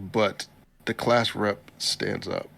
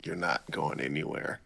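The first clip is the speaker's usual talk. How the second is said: quiet knowing murmur; 5 semitones lower, 6 dB quieter than usual. Retold or imitated. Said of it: "And I was like".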